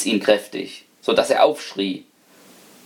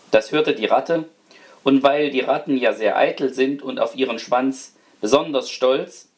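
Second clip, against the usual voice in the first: ordinary delivery, nothing marked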